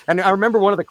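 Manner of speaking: usual speaking voice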